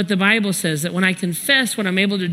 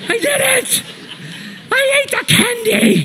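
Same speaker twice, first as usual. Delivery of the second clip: extremely high mock-child wail; hammed-up tearful shriek of confession; crying confession. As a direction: imitate a boy breaking down and squealing it out. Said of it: "crying. And he finally goes".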